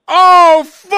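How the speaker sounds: loud sudden curse of dismay; dismayed outburst. Retold or imitated. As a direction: blurt it out like you're doomed. Retold firing, Pesci reaction.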